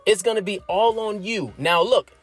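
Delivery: ordinary talk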